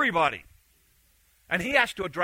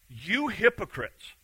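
quiet rebuke; 5 dB quieter, slower than usual. Retold or imitated. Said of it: "The Lord answered him".

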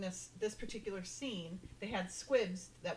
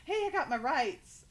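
acted protest; 6 semitones higher, 6 dB louder than usual. Suspect protesting in retold interrogation.

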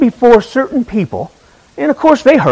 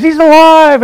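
raised, higher Easter proclamation; pastor's proclamation; 8 semitones higher, 5 dB louder than usual. Retold or imitated. Pastor proclaims to the congregation, raised and higher. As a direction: announce it like a pastor on Easter morning. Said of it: "pastor stands up there and says".

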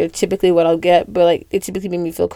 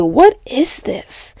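amazed; 5 semitones higher, much slower than usual. Retold or imitated. Amazed walking into McDonald's.

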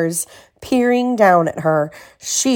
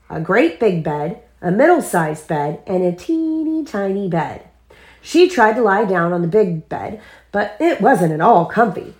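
ordinary delivery, nothing marked

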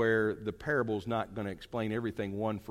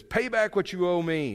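demanding servant; 7 semitones higher, 6 dB louder than usual. Acted. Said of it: "He says".

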